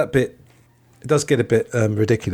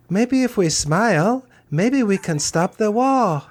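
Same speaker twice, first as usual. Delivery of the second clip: much higher, slow, sing-song Yoko voice; Yoko impression; 12 semitones higher, slower than usual. Podcaster doing Yoko's voice from the film, high and slow. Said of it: "I'm gonna do the voice".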